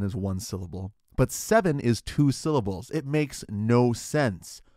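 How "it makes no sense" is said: usual speaking voice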